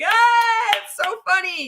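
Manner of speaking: high, loud fake hilarity; mock audience reaction. Imitating the crowd reacting to a weak joke, exaggerated amusement. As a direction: burst out in overdone delight. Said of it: "everybody's gonna go like".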